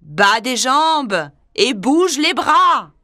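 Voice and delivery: deep panther voice. Acted. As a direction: speak low and steady. Bagheera voice.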